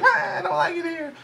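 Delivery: put-on whiny kid. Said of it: "he's like".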